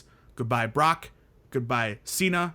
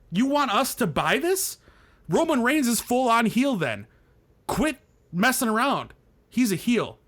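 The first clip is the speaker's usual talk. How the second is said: higher, heated disbelief; exasperated rant; 7 semitones higher than usual. Real, not acted. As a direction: rant it at full tilt. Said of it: "I need to rant".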